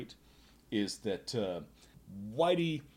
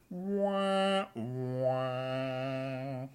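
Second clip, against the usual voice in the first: sad trombone wah; 4 semitones higher than usual. Acted.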